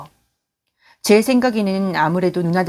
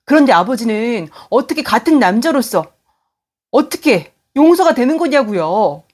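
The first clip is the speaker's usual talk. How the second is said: higher and louder, pleading in fury; angry demanding; 5 semitones higher, 5 dB louder than usual. Acted. Son furiously demanding answers from his passive father. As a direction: corner him, voice rising to a cry. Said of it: "답답하고 너무나 화가 나서 따지며 물었어요".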